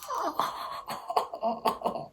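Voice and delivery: drawn-out, breathy laughing fit. Real, not acted. Storyteller cracks up at her own date story, laughing. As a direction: laugh in a long soft string of puffs.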